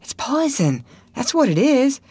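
put-on high, insistent boyish voice; emphatic warning. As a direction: insist on the danger to your friend. Tom explains the danger emphatically.

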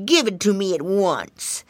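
storybook bully's demand; snail snapping. A narrator's reading. Snail demanding from the piggy boy, mildly snappish creature voice. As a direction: order it with mock menace. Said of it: "snapped and snipped the snail".

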